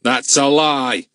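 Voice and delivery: slow, curt accusation; flat contempt; harsh. A narrator's reading. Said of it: "said Mister Tupping in a".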